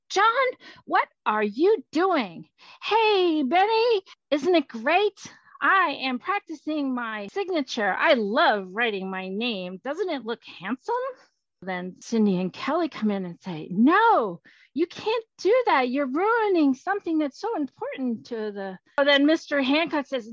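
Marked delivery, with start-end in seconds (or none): 0.1-2.5 high, loud, slow reproach; drawn-out disapproving exclamation; shocked scolding. Acted. Franklin catches Hancock writing on everything. 2.8-10.9 high, loud greeting easing into proud delight; excited, boastful storybook ghost; gleeful show-off Hancock. Acted. Plays gleeful Hancock showing off. 13.7-18.8 high, loud alarmed objection; dismayed child protesting; urgent protest. Acted. Children protest to stop Hancock.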